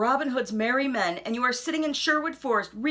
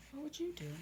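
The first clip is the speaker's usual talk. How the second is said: very soft, higher aside; 4 semitones higher, 16 dB quieter, faster than usual. Acted. Narrator softly asking the listener. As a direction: ask it gently under the breath.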